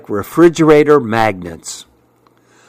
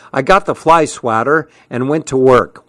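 ordinary delivery, nothing marked

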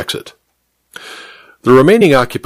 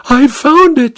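much higher, urgent cry; excited cry; 3 dB louder, slower than usual. A narrator's reading. Tearful housemaid rushing in with the plan, excited cry. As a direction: burst in with the discovery. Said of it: "she cried".

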